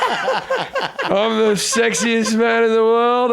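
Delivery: high, slow, shouted party brag; mock ecstasy boast. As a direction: belt it out like a high club guy. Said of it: "it would be like".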